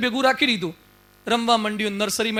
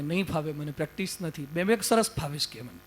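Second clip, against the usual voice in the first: timid lamb voice; 7 semitones lower, 10 dB quieter than usual. Acted. Cub meekly answers the lion, in a small, much softer lamb voice. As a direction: lower and much softer, meek little voice.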